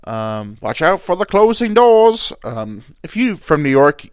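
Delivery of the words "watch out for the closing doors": loud, high train-announcer call; shouted train warning. Retold or imitated. Dad imitating subway announcements for his kid, shouted. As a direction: belt it out like a train worker. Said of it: "these places you keep shouting at me".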